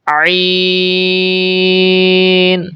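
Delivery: عین stretched very long from a squeezed throat; drawn-out Arabic عین, opening in mid-throat; mid-throat ayn. Shown as a demonstration. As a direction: recite عین on a held steady note. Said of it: "حلق کے درمیان سے ادا کرنا".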